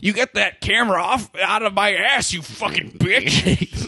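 put-on slow, drawn-out cussing old man; slow mock tirade from an angry old worker; angry Chauncey impression. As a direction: spit it at the cameraman like a furious old operator. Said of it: "I liked your impression of Chauncey".

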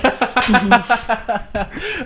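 high-pitched laughing fit; high giggle. Real, not acted. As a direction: burst into giggles. Laughing fit over the drink.

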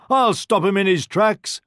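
high, loud farmer's call; farmer's cry. A narrator's reading. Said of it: "cried the farmer".

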